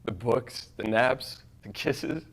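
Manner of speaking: tender, wistful goodbye to his wife; a husband saying sorry he'll never grow old with her; grieving husband monologue. Acted.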